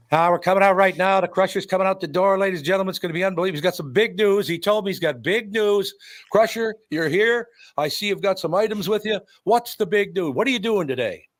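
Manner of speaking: high, excited wrestling interviewer; Marty O'Neill. Retold or imitated. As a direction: hype the big news in a high announcer voice. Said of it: "Marty O'Neill, who did the interview".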